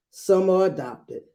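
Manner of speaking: ordinary delivery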